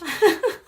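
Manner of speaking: short high giggle. Real, not acted. Reader amused by her own heartfelt wish, giggle. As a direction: let out a little burst of laughter.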